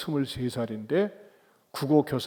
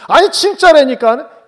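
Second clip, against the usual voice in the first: high, slow, loud acted insistence; insistent girl; 11 semitones higher, 13 dB louder than usual. Girl insists to fleeing Peter, high stubborn cry.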